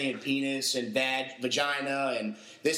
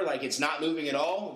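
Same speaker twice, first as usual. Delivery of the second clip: ordinary talk, nothing marked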